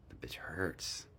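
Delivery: a soft unvoiced whisper, said under the breath; whispered aside. Real, not acted. To viewers about her sore lip, whispered. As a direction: mutter the pain aside, barely voiced.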